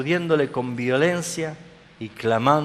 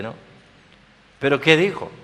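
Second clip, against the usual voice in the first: ordinary delivery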